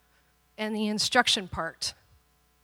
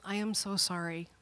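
remorseful apology; 7 dB quieter than usual. Retold or imitated. Daughter apologises.

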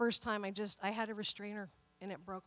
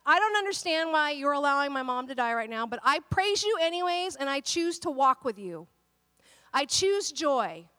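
declared prayer; 5 dB louder than usual. Pretend. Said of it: "and we say".

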